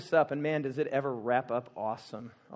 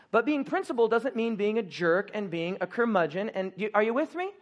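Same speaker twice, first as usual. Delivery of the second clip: usual speaking voice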